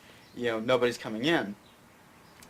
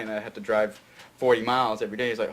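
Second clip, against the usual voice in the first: ordinary delivery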